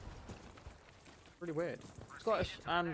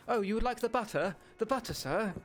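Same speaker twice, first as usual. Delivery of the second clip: higher, prim royal voice, hammed-up; the Queen; 8 semitones higher than usual. Friend retelling the shop, mocking a posh customer as the Queen. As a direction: play a posh lady offering butter. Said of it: "He made you sound like the Queen".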